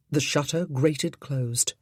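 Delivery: plain reading voice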